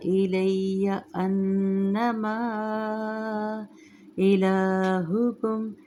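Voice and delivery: slow melodic tajweed chant; Quran recitation. Shown as a demonstration. Full recitation again.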